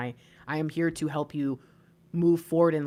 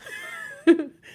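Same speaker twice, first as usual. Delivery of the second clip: high laugh; 12 semitones higher, 4 dB louder than usual. Real, not acted. Bursts out laughing, end of reading.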